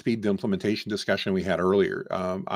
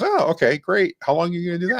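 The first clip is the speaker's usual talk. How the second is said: lightly voiced wife, pitched up; higher-pitched quoted reaction; imitating wife; 7 semitones higher, faster than usual. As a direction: retell her reply in a higher voice. Retold wife's reply to news.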